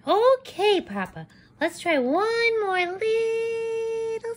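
lightly high chicken plea; begging chicken. Acted. Chicken begs for more.

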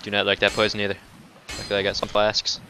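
ordinary talk, nothing marked

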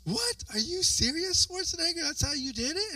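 high-pitched incredulous questioning; playfully acted amazement; mock disbelief. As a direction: react astonished, pitch jumping way up. Acts out disbelief at the trick.

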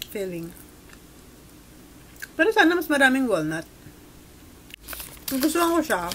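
chewing while talking; speaking between bites of cake; mouth full. Real, not acted. Seen 3: Lips closed chewing, cake at mouth, new bite around 9.5s.

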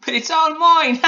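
very high, loud gloat into a laugh; Alan Taylor laughing. A narrator's reading. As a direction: crow with delight, then cackle. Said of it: "laughed Alan Taylor".

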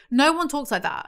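ordinary talk

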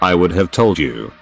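ordinary delivery

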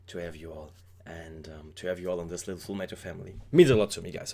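soft, low, heartfelt gratitude; sincere thanks. Real, not acted. Thanking viewers for all their kind messages, softly sincere.

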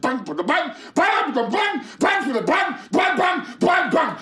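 high, loud frantic repeated bangs; shrieking bang, bang, bang as the terror takes over; panicked shrieking. Acted. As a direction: scream the word over and over in rising panic. Fear spirals into repeated shrieks.

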